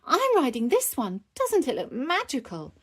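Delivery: high-pitched delight; delighted child. Acted. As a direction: chatter with eager wonder. Peppa thrilled with her unicorn.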